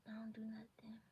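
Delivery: soft whisper. Real, not acted. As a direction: whisper it gently. Whispered confession.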